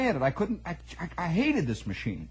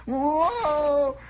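high yelp; 12 semitones higher, 5 dB louder than usual. Retold or imitated. Voicing the squealing car start.

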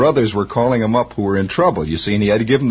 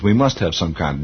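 ordinary delivery

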